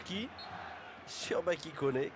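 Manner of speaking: ordinary talk, nothing marked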